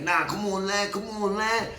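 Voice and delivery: a hint of a carefree buddy; imitating C. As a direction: lightly play the friend brushing it off. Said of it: "He's like".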